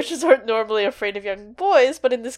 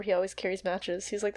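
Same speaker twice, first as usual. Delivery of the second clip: ordinary talk, nothing marked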